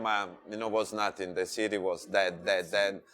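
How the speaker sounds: normal talking voice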